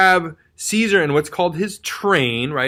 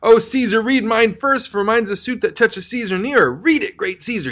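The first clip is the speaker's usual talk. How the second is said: higher, urgent pleading push; amped up and pushy; 6 semitones higher than usual. Retold or imitated. Petitioner pushing Caesar to read, amped up and pushy. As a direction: press him hard, a bit amped. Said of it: "He gets real, like, amped up and pushy".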